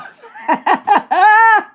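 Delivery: loud, high-pitched burst of laughter; big laugh. Real, not acted. Streamer cracking up at a chat message, laughing hard. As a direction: crack up loudly. Amused at chat's "Let's go, bruv.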